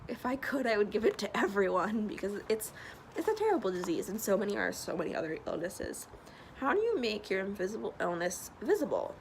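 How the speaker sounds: ordinary talk, nothing marked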